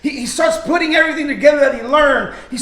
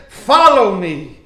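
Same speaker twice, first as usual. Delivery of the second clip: slow, loud, weighty command with a falling end; firm command; 3 semitones lower, 5 dB louder, slower than usual. Acted. Preacher repeating what Jesus said to Matthew, slow firm command. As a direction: declare it slowly, leaving no room for question.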